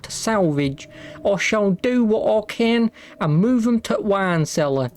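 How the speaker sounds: lightly put-on ye olde Victorian accent. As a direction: read the journal in an old Victorian accent. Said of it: "how'd you like my ye olde Victorian accent".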